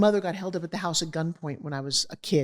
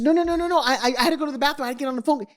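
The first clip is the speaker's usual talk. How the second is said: higher, faster mock panic, retold; frantic pleading; 8 semitones higher, 3 dB louder, faster than usual. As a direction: beg him to let her stay, fast. Woman pleading to stay in room.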